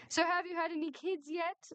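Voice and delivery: bright, high nosy question; pry in a high voice. A narrator's reading. Miffy quizzing Emma at the wedding, high prying tone.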